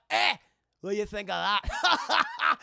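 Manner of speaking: loud Scottish taunt; gloating Scotsman. Retold or imitated. Scotsman has just cut Jack's hat, gloating. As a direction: crow it as a cocky Scot.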